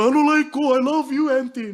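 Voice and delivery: high, loud pleading to auntie; pleading kid. Retold or imitated. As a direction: beg like a kid being kicked out. Student pleading with strict auntie.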